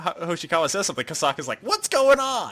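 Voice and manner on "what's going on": high, loud cry of alarm; flustered outburst. Retold or imitated. Kosaka reacting to innuendo, flustered outburst. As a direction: exclaim it in mock shock.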